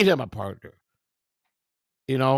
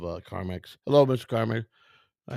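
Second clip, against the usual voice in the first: ordinary talk, nothing marked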